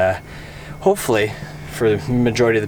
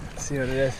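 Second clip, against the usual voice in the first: ordinary talk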